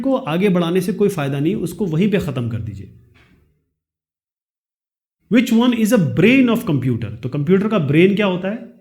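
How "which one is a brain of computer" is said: slow, careful reading of the quiz sentence; slow question reading. Shown as a demonstration. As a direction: read each word out slowly for the class. Reads question slowly. (धीरे-धीरे प्रश्न पढ़ना)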